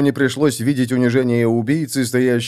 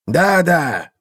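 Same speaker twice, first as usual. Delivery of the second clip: impatient, raised pitch; 7 semitones higher than usual. A narrator's reading. Seer answers his hired assassin, impatient. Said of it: "нетерпеливо ответил провидец".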